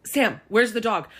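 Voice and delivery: dad waking her up a second time, slowly. Retold or imitated. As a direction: lean in and ask it slowly. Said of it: "he goes like this".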